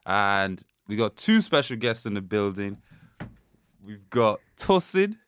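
upbeat host welcome; podcast host intro. Acted. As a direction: announce the guests, slowly.

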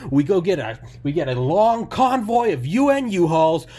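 high, loud screaming rant as Sam Kinison; Sam Kinison shout. Retold or imitated. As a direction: scream it like an unhinged comic. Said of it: "he goes".

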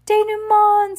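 high, loud desperate cry in a changed voice; anguished cry. Acted. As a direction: shriek the name in alarm. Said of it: "Sonny cried".

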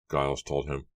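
plain reading voice

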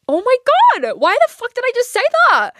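high, loud mock-panicked squeal; mortified squeal. Mortified at her own reply, squealing in panic. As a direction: shriek it at yourself in horror. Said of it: "I was so in my own head".

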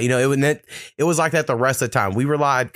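ordinary talk

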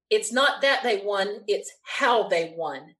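slow line, leaning on "how"; stress on how. Retold or imitated. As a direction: set "how" against "that", drawing it out slowly. Retold correction contrasting that and how.

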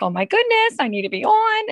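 imitating an anxious daughter, high and loud; freaking out. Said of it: "she starts freaking out".

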